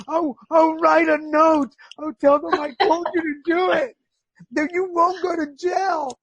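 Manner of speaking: high-pitched, wailing mock begging; crying plea. Exhausted patient reassuring reluctant partner, crying plea. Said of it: "but crying".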